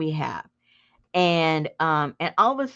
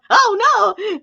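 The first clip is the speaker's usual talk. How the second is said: alarmed; 8 dB louder than usual. Retold or imitated. Husband realizing danger.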